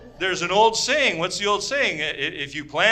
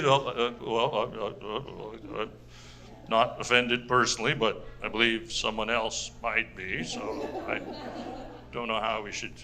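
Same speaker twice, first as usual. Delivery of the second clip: timid Canadian mumble; 8 semitones lower, 7 dB quieter than usual. Acted. Mock timid overly polite Canadian.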